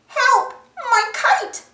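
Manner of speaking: high-pitched alarmed cry; crying out for help. A narrator's reading. Boy calls for help with his stuck kite, crying out. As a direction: call out in distress over a kite. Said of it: "cried Pedro".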